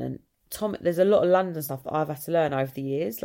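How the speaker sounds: usual speaking voice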